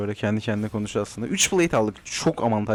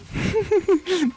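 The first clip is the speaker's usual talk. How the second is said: high laugh. Real, not acted. Streamer laughing at his own misclick, high laugh. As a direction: burst of high-pitched laughter.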